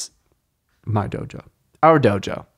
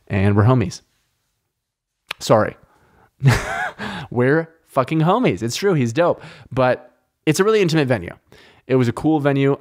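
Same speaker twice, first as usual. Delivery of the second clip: ordinary delivery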